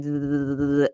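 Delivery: drawn-out vibrating zzz; sustained mouth-made buzz; buzzing imitation. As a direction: imitate a motor buzzing in a bag. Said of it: "and it was buzzing".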